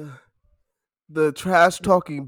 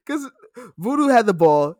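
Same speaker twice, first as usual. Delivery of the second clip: ordinary talk, nothing marked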